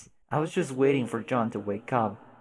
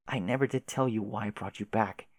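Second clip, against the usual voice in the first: ordinary delivery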